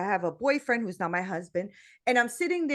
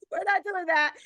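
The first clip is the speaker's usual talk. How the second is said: high-pitched, squeezed out through laughter; amused protest, breaking up; laughing refusal; 7 semitones higher than usual. Retold or imitated. Woman repeats her refusal to God, laughing helplessly.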